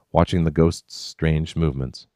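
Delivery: plain reading voice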